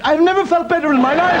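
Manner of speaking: high, strained English gent; posh Englishman. Acted. Englishman to farmer, high brave prim reply.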